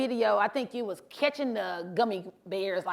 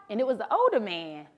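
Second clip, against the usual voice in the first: ordinary talk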